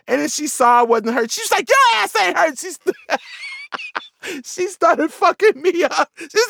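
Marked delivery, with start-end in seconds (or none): 1.7-2.6 high, scolding mock yell; mom's angry disbelief. Mom seeing her kid isn't hurt, angry high scolding. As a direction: call out the faker in an outraged high voice.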